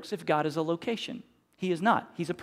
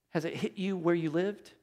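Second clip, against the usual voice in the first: ordinary talk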